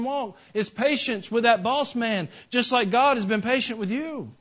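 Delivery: high, loud pulpit fervour; full-voiced sermon climax; fervent preaching. Real, not acted. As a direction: preach it with rising passion. Preacher rises to passionate pitch.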